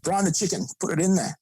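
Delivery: lightly hammed-up casual order; mock old routine. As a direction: send up how careless they used to be. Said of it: "be like".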